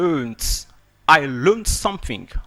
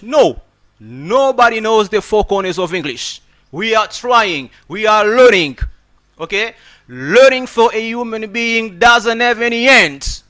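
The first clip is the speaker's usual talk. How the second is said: emphatic protest; 6 semitones higher, 7 dB louder than usual. Real, not acted. Emphatic rebuttal to imagined critic.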